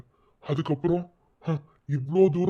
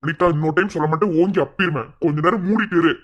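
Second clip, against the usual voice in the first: louder, hard warning; angry threat; 3 semitones higher, 5 dB louder than usual. Acted. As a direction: threaten her so she stops. Threatening the aunt to shut up.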